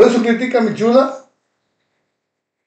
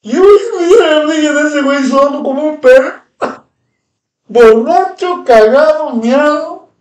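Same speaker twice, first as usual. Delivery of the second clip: angry rant; 6 semitones higher, 3 dB louder than usual. Real, not acted. Angry rant insulting a rival.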